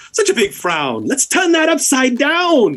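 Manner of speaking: rising high, slow singsong tease; mischievous satyr. Acted. Satyr teasing Sophia's frown, high slow singsong. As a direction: taunt her into a smile. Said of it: "He looks at you and he says".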